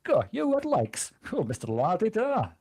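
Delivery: put-on high, slow scornful Yorkshire old man; Yorkshire accent. Shown as a demonstration. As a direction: sneer it like a Python Yorkshireman. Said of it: "with an accent".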